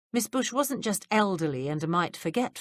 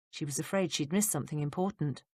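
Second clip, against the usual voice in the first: ordinary delivery, nothing marked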